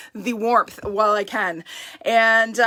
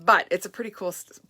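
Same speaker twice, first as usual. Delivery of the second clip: ordinary talk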